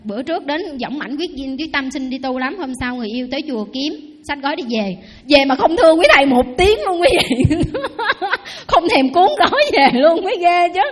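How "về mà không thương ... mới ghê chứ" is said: laughing loudly through the words. Real, not acted. Nun laughing while telling the congregation about the runaway monk, loud and amused.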